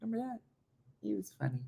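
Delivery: hushed aside to a pet; soft talk to dog. Real, not acted. Owner reminisces with her dog, soft aside. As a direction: share a memory softly with the dog.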